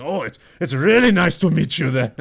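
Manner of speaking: put-on a butchered, overdone Russian accent; Russian accent impression. Fighter's teammate greets the host, in a butchered Russian accent. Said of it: "butchering the Russian accent".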